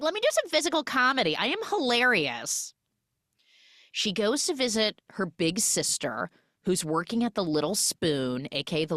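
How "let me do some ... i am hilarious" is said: high, rushed, hammed-up boast; mock self-satisfied. Podcaster mimics actress doing physical comedy, mock self-satisfied. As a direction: imitate someone proudly showing off, fast and high. Mocks a character's try at comedy.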